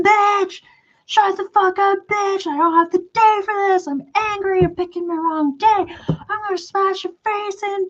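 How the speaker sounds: high-pitched screamed tirade; furious screaming imitation. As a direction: screech threats like an enraged stranger. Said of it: "she got fucking mad".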